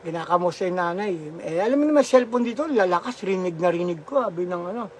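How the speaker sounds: ordinary talk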